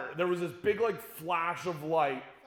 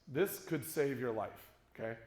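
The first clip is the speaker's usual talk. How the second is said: normal talking voice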